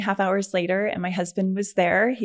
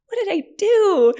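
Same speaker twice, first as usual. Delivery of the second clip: high, loud retold wail of self-reproach; hammed-up exasperated cry at yourself; mad at herself; 13 semitones higher, 8 dB louder than usual. Injured woman blames herself for the accident, high mock wail. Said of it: "I was so mad at myself".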